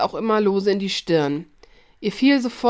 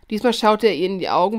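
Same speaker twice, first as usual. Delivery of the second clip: ordinary delivery, nothing marked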